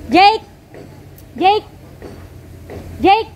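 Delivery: shouting a name across a room; calling out. Real, not acted. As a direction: call out to a friend far away. Calling friend across the place. (pasigaw na pagtawag)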